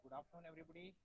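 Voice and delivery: very faint, far-off voice; barely audible. Real, not acted. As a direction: speak barely above a murmur. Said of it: "Sir, your voice is very low".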